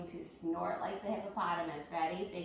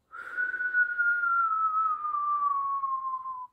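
long high whistle on the breath; noisy whistling breath; 7 dB louder than usual. Shown as a demonstration. Teacher demos the zookeeper's whistling breath, loud whistle. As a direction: blow loudly and whistle. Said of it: "make a noise with your breath".

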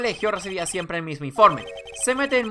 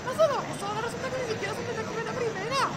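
high, soft, fast put-on voice; a squeaky onlooker voice; high character voice; 6 dB quieter, faster than usual. Onlooker mocking the fake detective, high soft voice. Voicing a character's mock remark.

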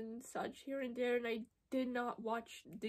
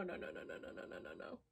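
whispered denial; 6 semitones lower, 8 dB quieter, much faster than usual. Real, not acted. Terrified denial fading down.

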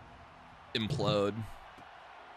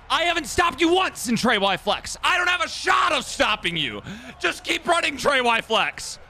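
high, loud, exasperated yelling; raging shout; 5 dB louder than usual. Real, not acted. Gamer yelling at opponent's repeated play, raging shout. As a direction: scream it at the opponent, voice way up.

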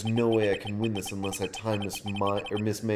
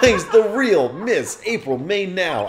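laughing; 8 semitones higher, 8 dB louder, slower than usual. Real, not acted. Laughing exclamation.

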